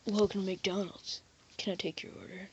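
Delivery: lightly voiced gloomy emo cashier, soft; emo fast-food worker. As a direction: trail off quietly like a listless emo kid. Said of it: "like, this emo guy".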